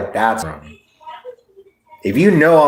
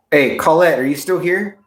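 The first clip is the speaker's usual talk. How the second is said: calling out; 7 semitones higher than usual. Real, not acted. Appelle Colette.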